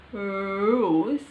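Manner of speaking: "rose" with rounded lips and a curled-back r; English rose. Shown as a demonstration. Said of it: "rose, like this".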